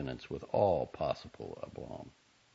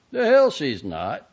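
slow, louder scoffing retort; 9 semitones higher, 12 dB louder, slower than usual. A narrator's reading. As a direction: stress on hell, dismissive. Quarrel over the sister.

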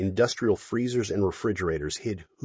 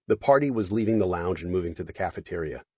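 ordinary talk, nothing marked

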